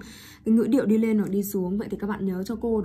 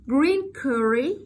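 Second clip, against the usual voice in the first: higher-pitched "Green curry?" ending upward; rising intonation; 4 semitones higher than usual. Shown as a demonstration. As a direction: lift "curry" like a surprised query. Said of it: "Rising intonation". (giọng cao, lên giọng ở "curry")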